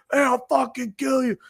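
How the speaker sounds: high-pitched holler, held back in volume; strained high pretend shout; mock yelled threat. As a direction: imitate a drunk yelling at passersby. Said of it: "this guy's yelling at people".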